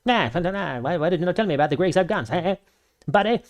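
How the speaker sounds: high, quick cartoon Canadian whine with a high buddy at the end; goofy Canuck voice, eh buddy; South Park Canadian. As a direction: rattle it off fast like a flappy-head cartoon Canadian. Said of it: "animating his head like".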